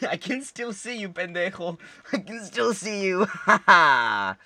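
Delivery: high, laughing jeer; gloating, high-pitched teasing; gleeful taunt. Pretend. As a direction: crow it through laughter, ending in a loud ha-ha. Taunting the monster while laughing.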